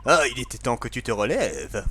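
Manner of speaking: voix virile de père; virile father voice. Acted. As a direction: play the gruff stern dad. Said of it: "on va faire une voix plus virile".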